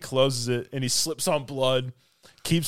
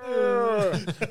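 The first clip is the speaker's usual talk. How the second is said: high droning "aah"; sustained mock groan; imitated moan; 10 semitones higher than usual. Retelling the moan that joins the singing, held high groan.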